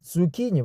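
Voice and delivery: "Zucchini" with a hard K, zoo-KEE-nee; corrected pronunciation. Shown as a demonstration. Learner copying the dictionary pronunciation, "Zucchini" said zoo-KEE-nee. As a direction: say "Zucchini" with K as in key. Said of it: "I heard about the pronunciation before".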